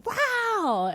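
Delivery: put-on high, delighted little-kid "Wow."; toddler's wow. Mom imitating her son's first word, high childlike wow. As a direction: pitch it way up like an amazed toddler. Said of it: "he would just go".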